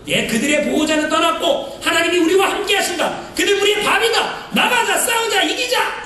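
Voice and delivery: rally the troops to charge; rousing battle cry. Retold or imitated. Preacher voicing Joshua and Caleb to the people, rousing shout.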